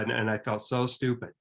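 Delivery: ordinary delivery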